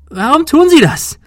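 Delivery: very high, loud, desperate scream; shrieked, overwrought question; voice cracking over. A narrator's reading. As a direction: scream it until the voice breaks. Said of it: "Ihre Stimme überschlug sich".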